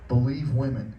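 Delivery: normal talking voice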